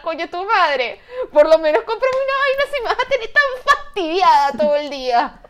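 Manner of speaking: high, very loud exasperated outburst; angry rant. Acted. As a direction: blow up at a pushy customer, voice soaring. Imagined telling-off of the pushy man. (bronca a gritos, indignada y muy aguda)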